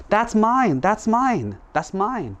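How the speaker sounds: high, eager repeated cries; excited claim. Acted. Woodcutter recognizes his old wooden axe, excited. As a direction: blurt it out in acted delight, pitched well up.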